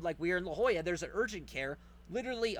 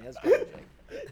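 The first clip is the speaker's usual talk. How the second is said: high, loud, laughing through the words; 6 dB louder than usual. Real, not acted. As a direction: let the laugh crack the line. Laughing repeat of the line.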